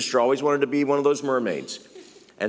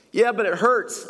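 complaining listener; 10 semitones higher than usual. Acted. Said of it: "voicing a hurting listener".

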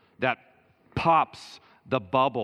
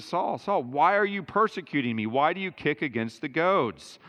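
higher and louder, voicing Jesus and Paul; impassioned preaching; 4 semitones higher, 3 dB louder than usual. Preacher urging the congregation, higher and louder. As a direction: declare it like a preacher in full flight.

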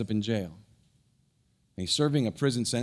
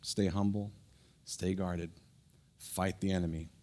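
quiet earnest exhortation; 5 semitones lower, 7 dB quieter than usual. Acted. Pastor quietly challenging the congregation, soft and earnest. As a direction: lean in and speak it gently.

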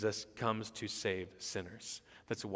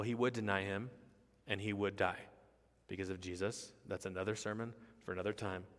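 usual speaking voice

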